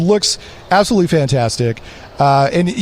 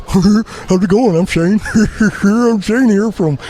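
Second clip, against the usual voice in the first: silly put-on voice; 3 semitones higher than usual. Radio host doing his goofy voice for a co-host. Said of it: "I'm gonna do my voice for you".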